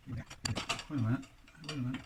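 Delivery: ordinary talk, nothing marked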